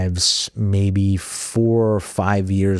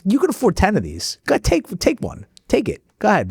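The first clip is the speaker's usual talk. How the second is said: insistent urging; 12 semitones higher than usual. Retold or imitated. Son insisting his father keep the truck, high urging. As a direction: pitch soaring up on take it.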